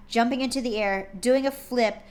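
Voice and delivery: usual speaking voice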